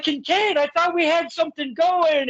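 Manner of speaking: high, hurt plea leaning over the ropes; hammed-up wounded hero; imitating the pleading wrestler. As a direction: play the betrayed babyface calling out to the heckler. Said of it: "You're like".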